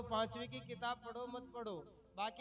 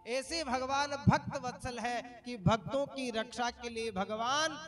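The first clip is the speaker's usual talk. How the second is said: loud, swelling devotional proclamation; preaching at full force; 8 dB louder than usual. Acted. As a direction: proclaim the Lord's love for devotees with full fervour.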